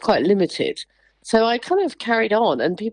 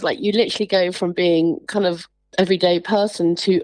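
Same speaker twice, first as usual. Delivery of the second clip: ordinary talk, nothing marked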